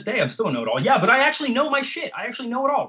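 high-pitched, worked-up protest; defiant know-it-all. Real, not acted. Mock-defiant rant defending being a know-it-all.

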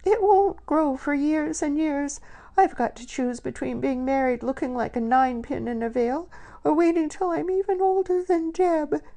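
put-on high crying lament of a young woman; tearful wail. A narrator's reading. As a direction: wail it out through tears. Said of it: "Lily wailed".

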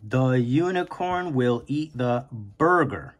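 sentence said slowly and evenly; slow teacher rereading. Shown as a demonstration. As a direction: reread it slowly to track the place. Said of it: "I just say it slowly".

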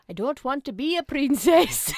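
a giggly Scottish try; bad Scottish accent. Asked for her Scottish guy, laughing Scottish accent. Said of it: "What's your little Scottish guy sound like?".

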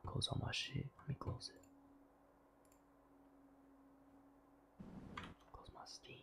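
hushed unvoiced whisper. Real, not acted. Streamer whispering so the game won't hear. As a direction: keep it to a breath. Whispering to keep mic low.

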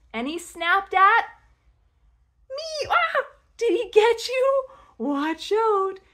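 high, loud pounce with a little shriek; playful alarm. Pretend. Pretend turtle snapping at the child, playful alarm. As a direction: build suspense, then squeal in mock fright.

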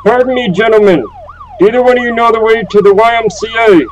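put-on polite doddering old gent; confused old man asking directions. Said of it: "most stereotypical old man I can".

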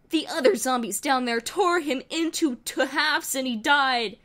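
usual speaking voice

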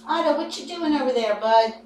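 loud, high call across the room. Real, not acted. Owner calling to Otto across the room, loud and high. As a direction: call him over to you.

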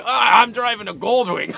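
loud boastful line ending in a laugh; bragging laugh. Retold or imitated. Business partner bragging to Murphy about his Goldwing. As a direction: show off the bike with a chuckle.

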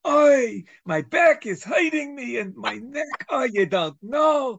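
put-on high, loud, kvetching Yiddish accent, slowed down. Talking dog kvetches to its owner, in a loud, high Yiddish accent. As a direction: groan it out like an old Yiddish complainer. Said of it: "Do the accent".

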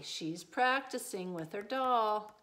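plain reading voice

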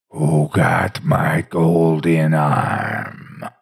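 low, slow voice at his ear; ghostly whisper-moan. A narrator's reading. Ghost bent over the bed, low slow moan. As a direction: breathe it slowly into his ear. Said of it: "the voice say, right at his ear".

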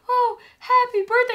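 high, loud imitation of a lonely fan's birthday wish; pretend Spider-Man greeting. Retelling a man wishing himself happy birthday, high pretend voice. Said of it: "he's pretending to be Spider-Man".

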